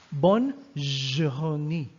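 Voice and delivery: "Bonne journée" very slowly with a long "zh"; slow teacher's model, "journée" starting on a held zh; drawn-out French J. Shown as a demonstration. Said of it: "It's zh".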